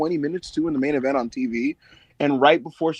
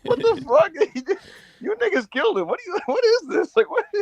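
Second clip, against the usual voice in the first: laughing disbelief. Retold or imitated. Mocking the FBI, laughing.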